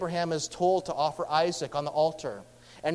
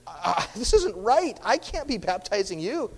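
flustered objection of a startled man; John balking, mildly hammed; baffled protest; 5 semitones higher than usual.

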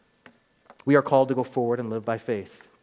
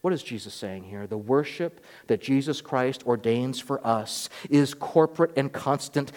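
normal talking voice

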